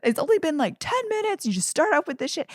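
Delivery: high, nagging wife voice with a laugh; mock whiny wife. Mocking the wife's nagging questions, high and whiny.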